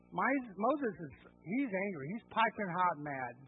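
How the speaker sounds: usual speaking voice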